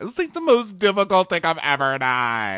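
high, whiny imitated complaint; mock crying. Contestant on leaving Robert, mock weepy. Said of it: "he starts frown smile crying".

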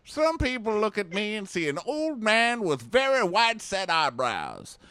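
high, loud mock old-timer; playful old man impression; hammed-up old man. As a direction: boast like a cocky old codger.

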